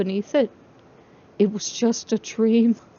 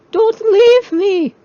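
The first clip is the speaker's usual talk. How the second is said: put-on much higher, louder and slow, a crying child's voice; wailing child; 12 semitones higher, 9 dB louder, much slower than usual. Crying toy face begging Charlie, high wailing child voice. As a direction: wail it like a terrified baby. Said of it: "the other wailed".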